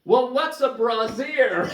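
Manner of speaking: booming the question across the aisle; full voice. Retold or imitated. Stocker loudly asking Phil what a brassiere is, full voice. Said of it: "in full voice".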